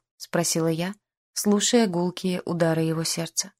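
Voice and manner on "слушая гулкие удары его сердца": usual speaking voice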